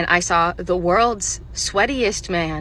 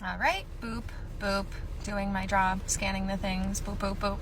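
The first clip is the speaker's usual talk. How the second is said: soft scanning sing-song to oneself; absorbed cashier; 9 dB quieter than usual. Acted. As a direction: murmur the scanner beeps softly.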